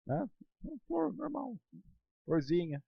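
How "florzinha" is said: ordinary delivery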